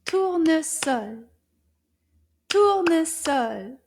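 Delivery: Tournesol loud and slow, in three punched beats; syllable by syllable. Shown as a demonstration. Teacher clapping syllables with children, Tournesol in loud separate beats. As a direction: clap out each syllable of Tournesol. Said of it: "Can we clap the syllables together?".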